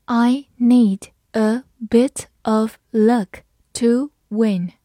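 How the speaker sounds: word-by-word slow teacher reading; very slow model sentence with gaps between words; slow read-aloud. Shown as a demonstration. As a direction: give each word its own beat, slowly. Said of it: "好，慢慢来读".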